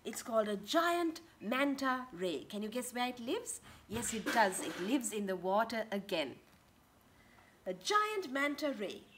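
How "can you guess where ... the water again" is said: plain reading voice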